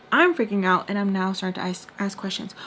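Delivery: ordinary talk, nothing marked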